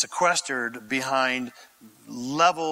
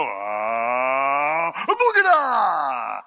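mock faith healer; 2 semitones lower, much slower than usual. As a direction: ham up a magic spell. Mocking a healer's hand-waving ritual.

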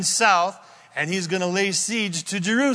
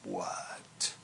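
breathless unvoiced whisper of disbelief; hushed amazed what; astonished whisper; 15 dB quieter than usual. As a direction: mouth it in stunned wonder. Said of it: "Isaiah's going".

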